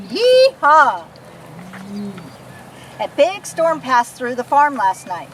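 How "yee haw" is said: long, drawn-out cowboy holler, louder; loud yee-haw. Pretend. Kid leading viewers in a cowboy cheer, loud drawn-out holler. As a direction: stretch the yee-haw out slowly and loudly. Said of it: "give us a big, loud".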